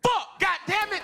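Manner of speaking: high, drawn-out frustrated cry; exasperated outburst. Retold or imitated. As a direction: throw up a slow, high-pitched curse. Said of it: "And then I just went".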